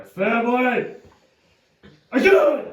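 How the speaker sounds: loud high taunting shout. Acted. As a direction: taunt him at full voice.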